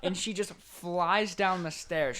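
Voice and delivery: ordinary talk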